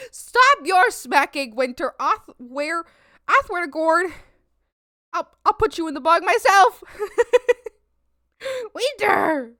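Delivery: loud, laughing fake threats; playful mock scolding. Real, not acted. Threatening her cat Winter, loud playful mock scold. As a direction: ham up a scolding of the pet.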